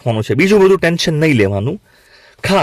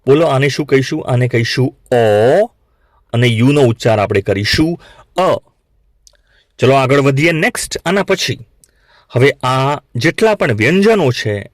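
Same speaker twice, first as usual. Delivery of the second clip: usual speaking voice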